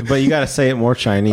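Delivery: ordinary talk, nothing marked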